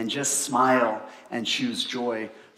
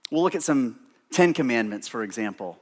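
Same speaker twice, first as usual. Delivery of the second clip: usual speaking voice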